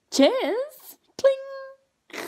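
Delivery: very high, loud toast; toasting herself with wine while the kids scream; mock posh toast. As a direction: squeak out a dainty cheers. Toasting wine amid chaos.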